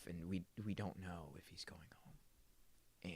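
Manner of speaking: unvoiced hushed whisper. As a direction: whisper it like a secret. Whispered suspense in retelling.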